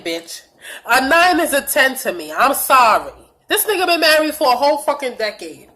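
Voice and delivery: ordinary talk, nothing marked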